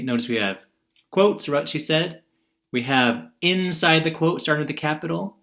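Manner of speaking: ordinary talk, nothing marked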